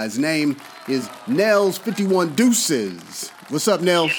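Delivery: big welcome shout-out; hype intro. Real, not acted. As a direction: bring the guest on like a radio host hyping him up. Hyped intro of phone guest.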